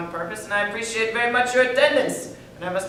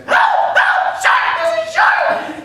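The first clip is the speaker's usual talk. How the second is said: high falsetto scream, loud; high-voiced swimmer screams at the shark; screaming for help; 7 dB louder, much slower than usual. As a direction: yell for help in a squeaky voice. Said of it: "he begins screaming for help".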